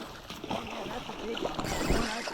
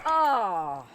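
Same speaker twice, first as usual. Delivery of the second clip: surprised; 12 dB louder than usual. Real, not acted. Fish got away.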